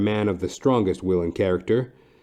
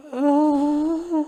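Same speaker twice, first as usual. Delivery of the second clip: a very high little gasp as she swoons; fainting gasp. Acted. The wife faints into the detective's arms, high gasp.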